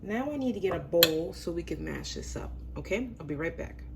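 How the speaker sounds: ordinary delivery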